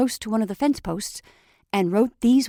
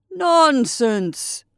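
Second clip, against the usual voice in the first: loud, higher hearty dismissal; cheerful cry; 6 semitones higher, 6 dB louder than usual. Acted. Santa brushes off the scarecrow's fear, loud hearty cry. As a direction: brush off the worry with a big cry. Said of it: "cried Santa Claus".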